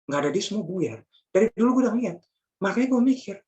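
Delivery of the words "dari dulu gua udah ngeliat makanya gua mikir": higher and louder, preaching hard; full-force preaching. Acted. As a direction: drive the point home from the pulpit.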